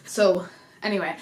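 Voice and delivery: normal talking voice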